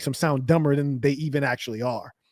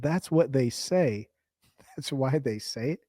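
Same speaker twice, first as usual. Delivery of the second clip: slow, softer, dopey parroting; slow, quiet mock of bro's line; mocking slow repeat; 7 dB quieter, slower than usual. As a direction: drag it out like he's dim. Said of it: "You said".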